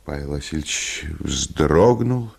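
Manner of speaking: ordinary talk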